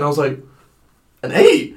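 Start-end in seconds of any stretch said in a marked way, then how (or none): 1.2-1.8 high, loud mock-astonished question. Student retells hearing his exam mark, in mock astonishment. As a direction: replay your disbelief, pitch leaping up.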